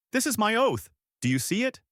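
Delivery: high-pitched impassioned cry; mild scream. A narrator's reading. Lan launching her boat with a vow, understated scream. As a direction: declare it high, short of screaming.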